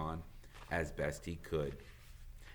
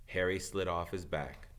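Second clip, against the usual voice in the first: usual speaking voice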